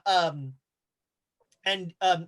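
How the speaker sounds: ordinary delivery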